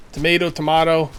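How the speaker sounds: tomato first as tuh-MAY-toh, then tomato as tuh-MAH-toh; both pronunciations. Shown as a demonstration. Player comparing accepted variants, tomato as MAY then MAH. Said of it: "I'll just say both".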